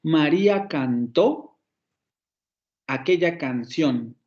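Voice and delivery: María cantó, pause, aquella canción; slow demo phrasing; phrased in three parts. Shown as a demonstration. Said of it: "Vamos a tener tres acentos".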